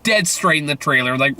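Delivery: normal talking voice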